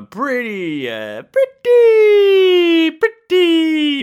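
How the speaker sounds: high, stretched-out sing-song on pretty; dramatic drawn-out emphasis. Acted. Telling viewers how big a deal it was, drawn out high and slow. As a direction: drag out the last words high and slow.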